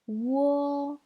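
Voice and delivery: "wo" held long, high and level; first tone. Shown as a demonstration. Teacher repeating the tone drill, "wo" high and level. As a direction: sustain "wo" on one high pitch. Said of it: "Je répète maintenant".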